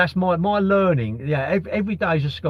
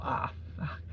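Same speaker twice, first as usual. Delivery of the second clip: whispered curse; 13 dB quieter than usual. Retold or imitated. Thinking on seeing the car hit.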